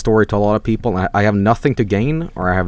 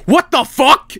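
high, loud shocked cry; mock fright; 4 dB louder, slower than usual. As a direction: yelp it like you just saw a monster. Imagined fright at Freddy Krueger.

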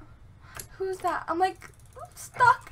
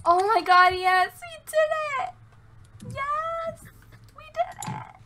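high, stretched-out squeal; real giddy victory cheer; elated celebrating; 10 semitones higher, much slower than usual. Real, not acted. Celebrating the win with friend.

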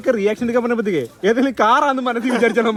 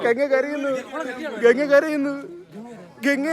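mock commentary; 6 semitones higher than usual. Vlogger teasing that Ganga is crying, mock excited announcing. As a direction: announce it like live news, grinning.

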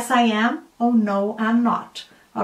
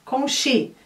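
usual speaking voice

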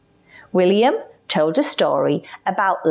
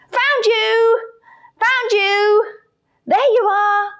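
put-on high, excited child voice; triumphant game shout; gleeful finding; 3 dB louder than usual. A narrator's reading. Playing hide and seek.

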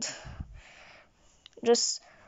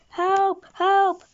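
loud, higher fake cry for help; pretend hostage scream; 7 semitones higher, 4 dB louder than usual. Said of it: "pretend I'm a hostage and scream".

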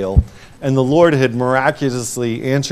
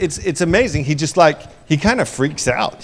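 ordinary talk, nothing marked

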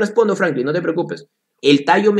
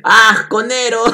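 louder, higher amused exclamation; amused outburst; 7 semitones higher, 4 dB louder than usual. Real, not acted. Teacher reacting to a student's joke, amused outburst. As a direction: burst out laughing at the joke.

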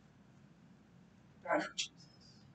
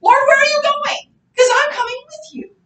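much higher, louder eager outburst; blurted out; 7 dB louder than usual. Retold or imitated. Peter blurting to Jesus, high eager outburst. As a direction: burst out like you can't hold it. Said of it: "he just blurts out".

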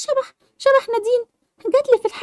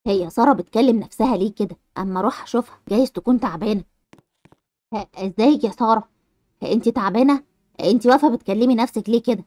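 much lower, a concerned grown man; man's voice; 13 semitones lower than usual. Acted. Man asking Sara why she talks to herself, low concerned voice. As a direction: speak low as a worried neighbour checking on Sara.

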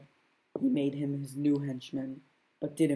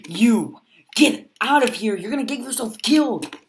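higher, louder, urgent warning; 8 semitones higher, 9 dB louder than usual. Acted. Warns the Chosen One to leave, loud and high. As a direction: shoo him away from danger.

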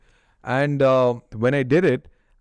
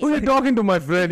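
defiant challenge; 9 semitones higher, faster than usual. Retold or imitated. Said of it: "you know, it's like".

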